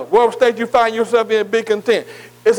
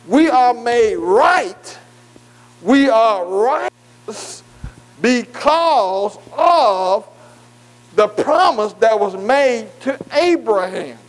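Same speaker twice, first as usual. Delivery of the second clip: drawn-out declaration; much slower than usual. Acted. Preacher stretching key declaration.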